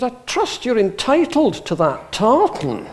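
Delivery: hammed-up Edinburgh accent, drawn out and imperious; grand Edinburgh lady. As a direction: imitate a haughty Edinburgh lady. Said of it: "very grand Edinburgh lady".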